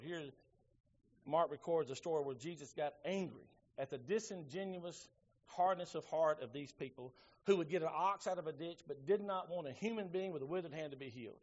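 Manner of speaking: usual speaking voice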